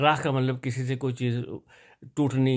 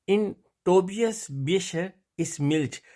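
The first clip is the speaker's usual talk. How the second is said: slow German model, starting higher; reading practice sentence; 5 semitones higher, slower than usual. Shown as a demonstration. Teacher reads before translating, slow and deliberate. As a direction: read it out carefully in two chunks. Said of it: "وہ میں آپ کو پڑھ دیتا ہوں".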